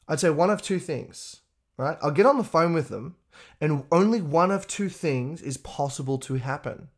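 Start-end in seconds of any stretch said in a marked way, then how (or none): none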